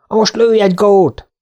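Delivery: high, drawn-out cry of command; slow, high-pitched cheer-on without full shouting; calling out. A narrator's reading. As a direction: call it out to a dog, pitched well up. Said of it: "kiáltotta Örni".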